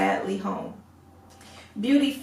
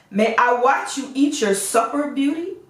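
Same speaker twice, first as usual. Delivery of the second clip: ordinary delivery, nothing marked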